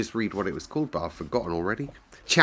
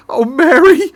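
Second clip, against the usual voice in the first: high, loud sobbing cry; 15 dB louder than usual. Acted. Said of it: "he cried out in a sob".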